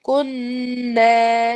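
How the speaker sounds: کننا with the doubled نون hummed long through the nose; کننا recited slowly with a nasal vibration; full ghunnah. Shown as a demonstration. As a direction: hold the nasal buzz in کننا, then stretch the ا. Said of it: "جیسی vibration ہو ... لمبا کر دینا".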